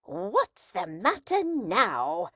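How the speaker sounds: slow, sharp demand from the stern sister; curt wife's question. A narrator's reading. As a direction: snap it at him like an impatient wife. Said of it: "said she smartly".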